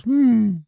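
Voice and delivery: short high, loud hummed beep; high loud electronic chirp hum; imitated chirp. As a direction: mimic a smoke detector chirp sharply. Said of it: "dog goes".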